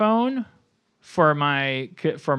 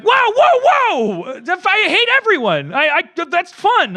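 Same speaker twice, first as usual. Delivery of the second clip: shrieking high, loud, over-the-top mock panic; mock unhinged self; 8 dB louder, slower than usual. Dan parodying his own reaction to critics with a loud, high, manic squeal. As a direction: play yourself cracking up into a manic squeal, much higher and louder. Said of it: "And I was like".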